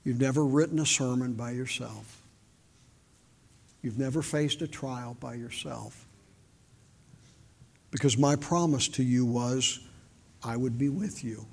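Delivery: low, soft reassurance with long pauses; gently assure, sentence by sentence; Spirit speaking inwardly. Retold or imitated. The Spirit consoling a lonely soul winner, softly reassuring. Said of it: "the Spirit spoke into my heart".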